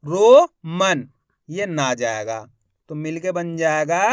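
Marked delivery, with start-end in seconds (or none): 0.0-1.1 "Roman" stretched as two slow syllables; "Roman" broken up slowly, first half louder; split into syllables. Shown as a demonstration. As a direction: draw out "Roman" as a long rising ro, then man. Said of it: "मा का उच्चारण हो रहा है".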